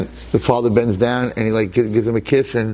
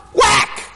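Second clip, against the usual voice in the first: mock slap sound effect.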